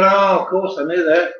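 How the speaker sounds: high, mock casual oh of course; a teasing put-on know-it-all voice. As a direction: pretend you always knew it. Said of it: "You're going".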